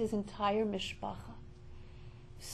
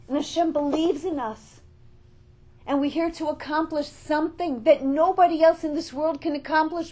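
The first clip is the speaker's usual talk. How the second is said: loud, higher, forceful sermon delivery; rousing preaching; 7 semitones higher, 9 dB louder than usual. Real, not acted. Speaker tells the congregation each has a mission, rousing preaching. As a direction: preach it out to the whole room.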